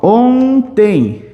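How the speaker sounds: "ontem" very slow, nasal O held; nasalized syllables. Shown as a demonstration. Teacher sounding out the word for students, slow nasal "ontem". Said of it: "uma ideia de nasalização do O".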